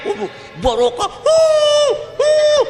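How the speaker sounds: hammed-up gushing over a kiai's blessing, a very high squeal at the end; mock gushing. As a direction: overdo the delight of kissing a kiai's hand. Mocking excited reaction to kiai.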